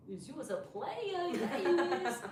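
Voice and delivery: high, slow cooing with a laugh. Real, not acted. Teasing her puppy as a player, cooing baby talk.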